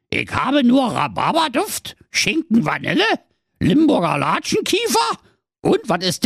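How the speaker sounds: squeaky Berlin-tinged dwarf, chatty; a cheeky high gnome voice. Acted. The dwarf explains the world edge to Marco, squeaky. Dwarf lists his stock.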